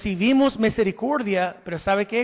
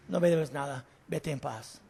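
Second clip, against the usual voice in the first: gentle king; 8 semitones lower, 8 dB quieter than usual. Acted. King forgives the debt.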